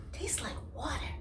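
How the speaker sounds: high, breathless little whisper. Acted. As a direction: whisper it behind a sip of soup. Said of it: "whispered Rat".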